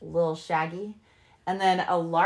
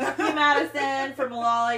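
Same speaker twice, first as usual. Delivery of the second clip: mock curse through laughter, a bit higher and louder; giggling insult; laughing; 6 semitones higher, 4 dB louder than usual. Real, not acted. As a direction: laugh while cursing her out.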